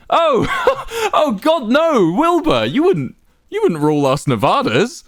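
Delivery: high, loud laughing burst. A narrator's reading. Quackity amused at Wilbur's mistake, laughing loudly. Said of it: "he chuckled".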